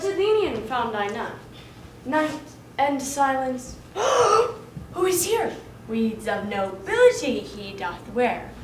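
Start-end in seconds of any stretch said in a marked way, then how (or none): 3.9-5.5 gasp, then a high, drawn-out startled question. Acted. Sprite stumbling on a sleeper, high startled gasp. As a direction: catch your breath at the discovery.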